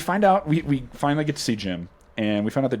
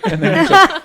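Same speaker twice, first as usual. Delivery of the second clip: laughing; 11 dB louder than usual. Real, not acted. Laughing while retelling scene.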